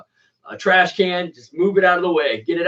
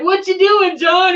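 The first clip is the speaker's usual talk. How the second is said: high, loud shouting wife voice; wife's shout; 4 dB louder, slower than usual. Retold or imitated. The wife calling out to John in the yard, high shout. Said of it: "His wife's like".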